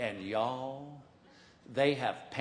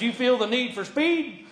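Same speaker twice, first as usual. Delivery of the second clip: cocky pilot; 8 semitones higher, 5 dB louder than usual. Retold or imitated. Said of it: "He goes".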